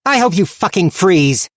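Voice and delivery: high, loud furious shout; hollered curse. Acted. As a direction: yell it back across the wind. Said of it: "turning back to holler at Tulla".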